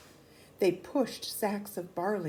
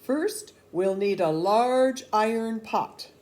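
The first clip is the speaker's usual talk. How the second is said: loud command; 3 semitones higher, 10 dB louder, slower than usual. A narrator's reading. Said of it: "the soldiers said".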